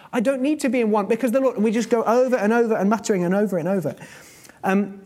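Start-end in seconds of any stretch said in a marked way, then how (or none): none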